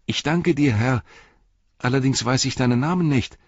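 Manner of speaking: grateful hobbit. Acted. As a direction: give earnest thanks in a high voice. Grateful Merry thanks the rider.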